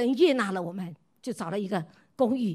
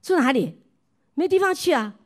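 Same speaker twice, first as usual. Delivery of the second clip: mock alarm; 4 semitones higher, 5 dB louder than usual. Retelling panic of eviction.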